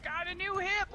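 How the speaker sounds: high-pitched, offhand fib; breezy bluff. Retold or imitated. Friend making up news about grandpa, breezy bluff. Friend improvises lie as Scott.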